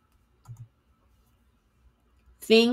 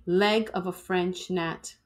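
plain reading voice

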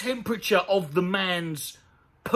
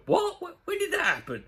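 baffled surprise; 10 semitones higher than usual. Pretend. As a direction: play someone who has no memory of it. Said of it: "And you go".

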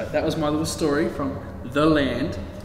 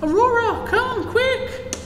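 much higher, louder call from the walls; urgent call; 6 dB louder, slower than usual. Acted. Princess Peach calling Aurora from the parapet, high urgent shout. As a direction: shout down for her to hurry.

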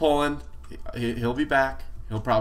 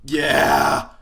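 cheer; 2 semitones higher, 8 dB louder than usual. Real, not acted. Cheering a kill.

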